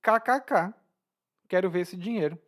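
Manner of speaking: high-pitched mocking challenge; acted sarcastic disbelief; ironic. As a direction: scoff with a spelled-out laugh. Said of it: "como uma maneira de ironizar".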